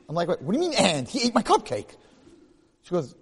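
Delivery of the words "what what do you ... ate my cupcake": incredulous. Retold or imitated. Wife shrugs off the cupcake.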